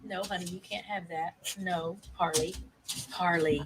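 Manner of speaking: quiet aside to a pet off to the side; gentle hushed no to a misbehaving dog; hushed scolding to the dog. Real, not acted. Shushing her dog off-mic.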